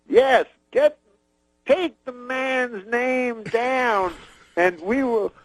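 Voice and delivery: very high, very slow Cosby orders; Cosby instructing his manager, high and drawn out; Bill Cosby impression. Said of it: "He goes".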